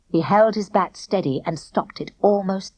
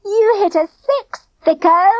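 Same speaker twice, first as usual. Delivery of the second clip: laughing; 11 semitones higher, 5 dB louder, slower than usual. A narrator's reading. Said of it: "Denzel laughed".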